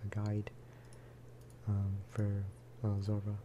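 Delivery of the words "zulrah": ordinary talk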